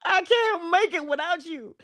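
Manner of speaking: high, hammed-up plea to stay; pleading old attitude. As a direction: mock-desperate begging, climbing high. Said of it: "old attitude is saying".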